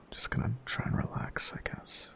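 soft nervous whisper to herself; whispering to self. Real, not acted. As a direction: breathe it out quietly, unsure.

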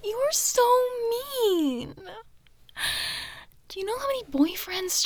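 very high-pitched mock-hurt whine; pouty whine. Girlfriend pouting at her laughing partner, high whine. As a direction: whine it like a wounded sulk.

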